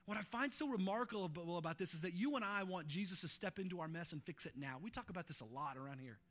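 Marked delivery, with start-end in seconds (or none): none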